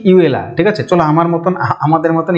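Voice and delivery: usual speaking voice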